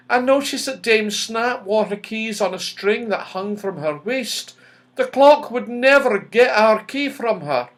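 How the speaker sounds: lightly voiced fairy girl explaining; Silky, fairy girl. A narrator's reading.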